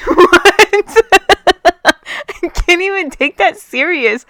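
high, loud laughing disbelief. Real, not acted. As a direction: crack up at the question. Absurd question about arresting vegans.

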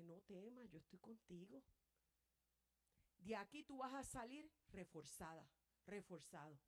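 hushed tender promise; gentle reassurance. Acted. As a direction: speak as God soothing the weary. Voicing God's comforting reply.

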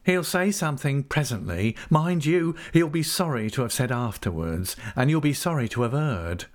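uneasy schemer's threat; warning schemer. Acted. Narrator voices Uriah warning.

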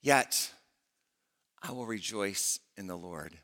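rising, sung-out preaching that builds then drops to a hush; preaching prayer. Acted. Preacher reads Habakkuk's prayer to the congregation, building then hushed.

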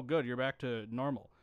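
ordinary delivery

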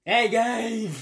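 loud, high call across the room. Real, not acted. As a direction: shout his name to get his attention. Calling out to Gabe.

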